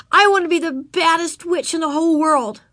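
put-on high child voice, full of ambition; boastful little girl. A narrator's reading. Ramona tells Mama her witch plans, boastful high child voice. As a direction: declare it like a determined kid.

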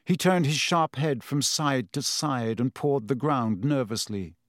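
plain reading voice

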